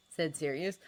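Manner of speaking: plain reading voice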